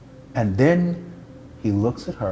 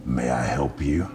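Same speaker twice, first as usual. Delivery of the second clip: low, deep mock tough guy; toughest voice; 11 semitones lower, 4 dB quieter than usual. To a smiling stranger, a low mock tough-guy challenge. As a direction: puff up like a macho tough guy. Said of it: "I say in my toughest voice".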